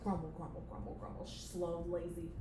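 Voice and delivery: low, quiet seething mutter; grumbling boss. Acted. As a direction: grumble insults under your breath. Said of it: "playing the boss character".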